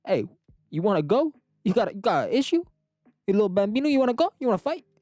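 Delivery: put-on Italian mobster accent, itching to brawl; Italian tough guy. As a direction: pick a fight in a thick Italian mobster accent. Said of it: "why are you talking like that?".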